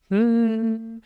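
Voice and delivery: sustained high hum; humming demo. Shown as a demonstration. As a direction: hum a single note up in head voice. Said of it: "if you're gonna hum a note".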